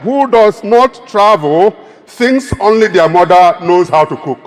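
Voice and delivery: ordinary talk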